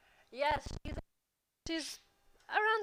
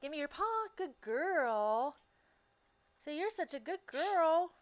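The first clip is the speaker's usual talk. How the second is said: higher-pitched doggy talk, stretching the praise; 5 semitones higher than usual. Real, not acted. Owner asks her dog for a paw, praising it in pet talk. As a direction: coo proudly to a pet.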